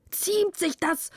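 hoch und atemlos herausgepresst; gepresst, noch außer Atem. A narrator's reading. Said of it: "presste er heraus, noch außer Atem". (atemlos herausgepresst)

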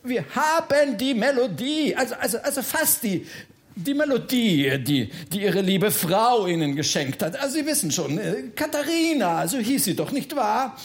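high, urgent, stumbling plea; flustered stalling. Acted. Bertha begs the Freiherr not to open, flustered. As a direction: scramble for words to stall someone.

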